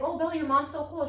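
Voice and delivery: put-on high, loud gushing kid voice; excited teen voice. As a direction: gush like an impressed teenager. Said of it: "everybody's like".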